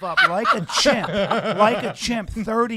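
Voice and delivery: giggling through the words; laughing. Real, not acted.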